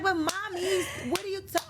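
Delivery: high-pitched child protest, imitated; protesting daughter. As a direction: plead back like a put-upon little girl. Voices daughter protesting to mother.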